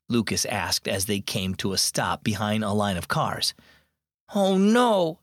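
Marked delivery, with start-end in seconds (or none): 4.4-5.2 mild dismay, higher pitch; narrated boy's dismayed cry. A narrator's reading. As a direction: let the worry lift the voice a little. Lucas dismayed at traffic jam.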